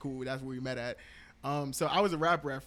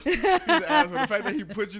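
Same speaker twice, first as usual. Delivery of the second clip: high, loud, laughing jab; laughing exasperation; 9 semitones higher, 9 dB louder than usual. Real, not acted. Twin reacting to his brother's prank, laughing and loud. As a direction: crack up while calling out your brother.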